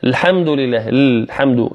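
الحمد begun on a bare L, "l-hamdu"; dropped hamza. Shown as a demonstration. Teacher imitating a mistake, الحمد begun on lam. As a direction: start الحمد on the lam without its A. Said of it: "لا يذكر الهمزة".

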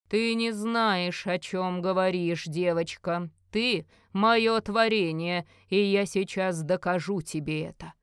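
slow, heavy villain's voice, stressing each phrase; menacing old sorcerer. Acted. Merlin threatens the girl he is choking, slow menace. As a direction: draw it out with imperious menace.

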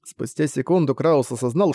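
usual speaking voice

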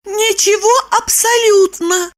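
high, whiny woman's voice; assuring Lipochka. A narrator's reading. Woman assures Roman she remembers nothing, high assuring voice. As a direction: assure him in a high put-on voice. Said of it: "заверила Липочка".

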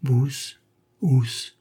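faltering syllables, one at a time; balbutiement. A narrator's reading. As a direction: sound out the scrawl uncertainly. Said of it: "elle balbutia à son tour". (balbutiement hésitant)

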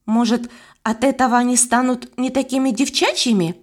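plain reading voice

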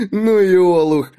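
loud guffaw then jeer; mocking laugh. A narrator's reading. Dak laughs at Mik's story, loud mocking guffaw. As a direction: laugh him off as a fool.

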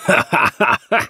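high, rattling burst of laughter; loud mocking laugh. A narrator's reading. Boss laughing at request for pay, mocking laugh. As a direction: laugh in his face. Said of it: "Jameson laughed loudly".

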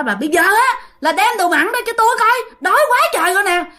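high, insistent mock yell; imitating demanding outcry. Hungry ghost orders wife to bring meat, high demanding yell. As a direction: demand it in a high-pitched cry. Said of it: "cái la làng lên".

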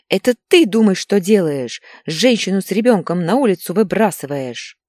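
loud, outraged wife, starting high; angry accusation. A narrator's reading. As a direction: fling the reproach back at him. Wife answers in shouting match. (возмущённое обвинение)

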